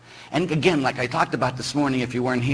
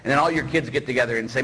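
ordinary talk, nothing marked

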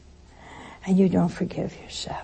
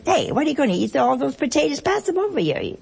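mock rowdy Italian diner; 6 semitones higher, faster than usual. Priest mimicking Italian relatives grabbing potatoes, hammed-up and high. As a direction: tease the guest about the potatoes, half laughing. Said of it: "somebody would say".

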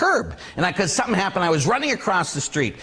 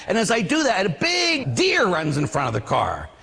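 normal talking voice

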